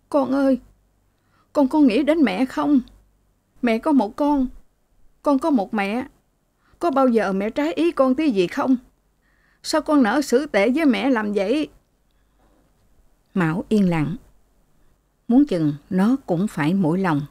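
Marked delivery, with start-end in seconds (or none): 0.1-11.7 higher, pleading mother in a lightly voiced lament; sobbing mother. A narrator's reading. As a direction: beg your son, voice pitched up in mild tears. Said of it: "nức nở khóc". (mẹ nức nở van nài con, giọng cao)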